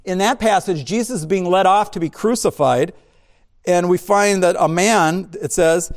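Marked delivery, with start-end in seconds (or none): none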